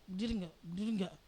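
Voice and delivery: hissed low through nearly closed lips; almost without opening the mouth, low and soft; through barely open lips. Retold or imitated. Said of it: "mulut saya hampir nggak terbuka".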